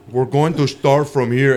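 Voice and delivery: put-on Brazilian accent. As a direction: imitate a big man with a Brazilian accent. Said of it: "it was Brazilian".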